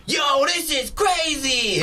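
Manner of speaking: high, slow hyped-up shout; excited audience shout. Retold or imitated. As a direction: yell it like a rowdy moviegoer. Rowdy audience yelling at screen.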